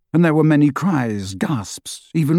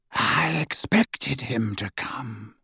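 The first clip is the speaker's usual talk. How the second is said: hushed, very slow villain voice; soft and drawn out, coldly controlled; Voldemort; 7 dB quieter, slower than usual. A narrator's reading. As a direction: speak slowly, barely above a murmur. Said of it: "said Voldemort in his high, clear voice".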